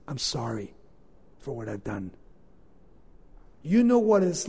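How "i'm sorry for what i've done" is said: very soft, low confession; humble prayer of apology, very soft and low; repentant. Acted.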